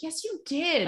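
high and soft, meek insistence; Greg's timid correction. Retold or imitated. Podcaster imitating Greg softly correcting Logan, high and meek. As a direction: squeak it out quietly. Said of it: "And Greg is like".

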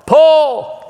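sudden high shouted call; yell. Acted. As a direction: yell it like launching a clay target. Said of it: "and yell".

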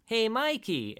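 high-pitched cartoon turtle greeting; turtle impression. Brothers come out and greet Mikey, turtle impression. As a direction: call out brightly as a cartoon turtle. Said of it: "really good turtle impression".

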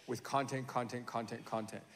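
soft, low monotone chant; droning repetition. Shown as a demonstration. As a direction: let the repeats drone on quietly. Droning list of endless content.

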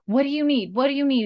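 quick urgent plea for help; urgent question. Retold or imitated. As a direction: Jamie demanding what Claire needs. Jamie urgently asking.

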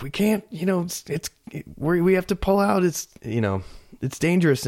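high-pitched imitated yell without real volume; retold yelling. Engine crew calling in over the scanner, mock yelling. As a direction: mimic a frantic radio call, high but held back. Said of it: "yelling and like".

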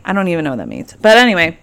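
ordinary delivery

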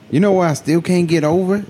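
ordinary talk, nothing marked

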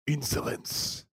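hissed, unvoiced alien outburst; enraged alien whisper. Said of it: "The enraged but quivering voice of the Vorlon".

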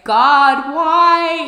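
high, loud cry to the heavens; anguished plea. Retold or imitated. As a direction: wail it up at God in despair. Retold crying to God on floor.

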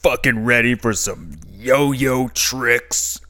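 put-on slow, swaggering kids' TV host; cool kids' show host. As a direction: play a crude host talking to kids. Host mocks kids' show opener.

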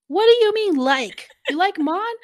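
indignant, high, loud outburst; retold shout. Kun-sam overhears Kirk say he likes Mon, shouting in disbelief. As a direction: act out a shocked shout, pitch leaping up. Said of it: "there's this shout".

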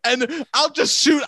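ordinary talk, nothing marked

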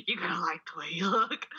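imitated commercial whisper; low-voiced, whispery ad slogan; weird whisper. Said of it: "where that weird guy whispers".

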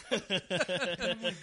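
high, loud laugh through the words. Real, not acted. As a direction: laugh out loud while talking, stretched slow. Laughing while retelling.

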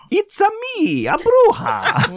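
put-on high cackling old bruja; witch voice. Shown as a demonstration. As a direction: squeal it like a Mario-style witch. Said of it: "Voice check".

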